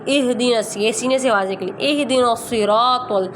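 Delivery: the ha in اہدنا breathed from the chest. Shown as a demonstration. Teacher showing the ha sound from the chest. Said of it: "ہا کی آواز نکالیں".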